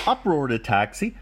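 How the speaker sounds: ordinary delivery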